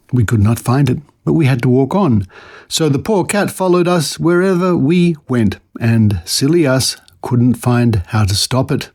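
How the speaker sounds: ordinary talk, nothing marked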